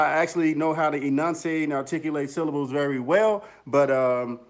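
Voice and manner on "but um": ordinary talk, nothing marked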